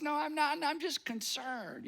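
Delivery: high-pitched protest that slows down on the excuse; acted defensive insistence; mock-defensive denial. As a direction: play someone wriggling out of an accusation. Acting out renewed denial.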